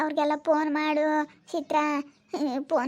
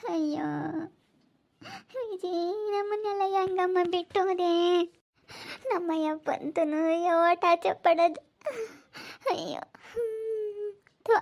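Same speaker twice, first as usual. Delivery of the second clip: sobbing; 2 semitones higher than usual. Real, not acted. Mourner asks Viji how she could leave them, sobbing. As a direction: sob it out to her.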